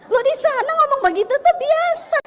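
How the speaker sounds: usual speaking voice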